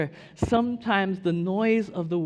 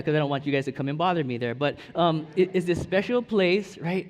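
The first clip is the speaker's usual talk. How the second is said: ordinary talk, nothing marked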